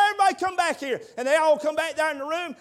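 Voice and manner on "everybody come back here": loud high call to the family; preacher calling relatives down the hall. Retold or imitated. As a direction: shout them back into the room. Calling family back into room.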